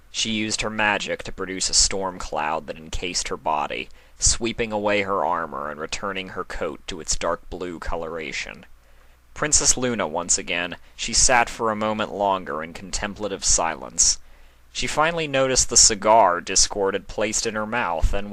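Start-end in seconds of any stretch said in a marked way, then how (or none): none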